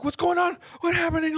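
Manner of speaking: high mock-panicked questions; panicking. Girlfriend seeing him fallen, high panicked questions. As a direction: imitate someone panicking, voice shot up high. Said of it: "Like, she's panicking".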